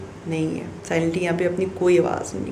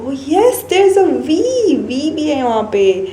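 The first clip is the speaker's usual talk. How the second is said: put-on child's answer; 8 semitones higher, 4 dB louder, much slower than usual. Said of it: "Then he will say".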